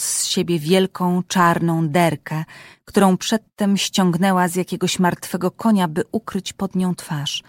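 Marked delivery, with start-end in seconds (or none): none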